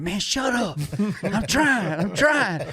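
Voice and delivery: high, pressed and tense: shut up, I'm trying; a hunter rattled with buck fever, hissing back at his cameraman; strained retort. Retold or imitated. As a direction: snap back, nerves all on edge. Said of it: "I'm like".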